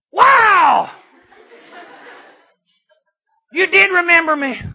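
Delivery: high, loud delighted cry with a laugh; joyful amazement. Acted. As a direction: shout with glad surprise. Thief meets Jesus in heaven.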